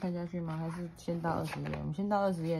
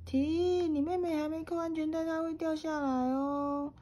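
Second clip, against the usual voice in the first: alarmed dad shout; 13 semitones higher than usual. Acted. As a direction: yell stop at the child, alarmed. Dad stops reckless cart pushing.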